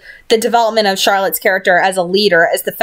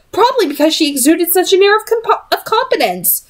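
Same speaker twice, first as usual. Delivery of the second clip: ordinary delivery, nothing marked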